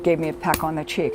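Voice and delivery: ordinary talk, nothing marked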